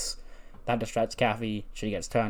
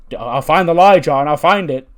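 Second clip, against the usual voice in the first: loud, raised voice, imitating a sore pest; insistent calling after; 4 semitones higher, 11 dB louder than usual. Bobby calls after a laughing Cena, insistent.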